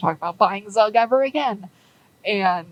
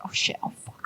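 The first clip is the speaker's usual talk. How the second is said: hushed, voiceless cursing; swearing under your breath in panic; panicked whisper; 13 dB quieter, faster than usual. Retold or imitated. Lucky panicking after the crash.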